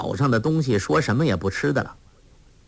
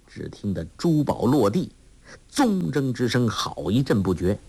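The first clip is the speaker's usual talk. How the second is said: ordinary delivery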